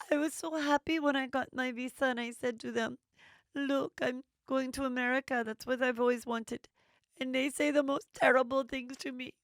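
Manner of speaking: high-pitched put-on voice of a hurt Brazilian friend; imitating Juliana. Host imitating Juliana describing her family's reaction, in a high put-on voice. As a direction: mimic Juliana complaining about her family, pitched well up. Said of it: "Juliana's like".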